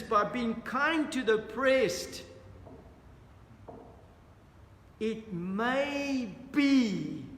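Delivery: slow, loud, deliberate reading of the verse; solemn scripture reading. Acted. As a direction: proclaim the text slowly like a pulpit reading. Preacher reads scripture aloud.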